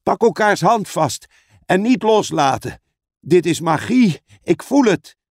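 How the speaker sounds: high, pressing orders; urgent shouting. Acted. Edmond telling the others to hold hands against magic, shouting. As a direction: shout instructions in the emergency. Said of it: "schreeuwde Edmond".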